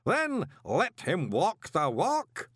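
high, very slow ritual command; commanding unseen voice. A narrator's reading. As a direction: draw each word out like a ceremony master. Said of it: "the unseen voice commanded".

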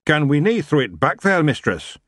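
eager Scottish plea; hopeful Big Yan. A narrator's reading. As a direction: ask hopefully in broad Scots. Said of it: "Big Yan said hopefully".

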